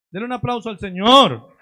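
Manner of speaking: loud, rousing call for applause; loud call to applaud. Acted. Pastor urges the congregation to applaud the Lord, loud rousing call. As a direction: fire the crowd up to clap for the Lord.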